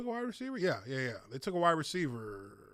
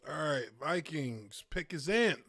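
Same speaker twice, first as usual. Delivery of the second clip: usual speaking voice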